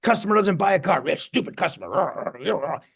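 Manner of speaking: mock grumble in a put-on voice that slows down at the end; hammed-up imitation of a sulky car salesman; grumbling coworker. Mocking a negative cubicle neighbour, put-on grumble. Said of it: "He's like".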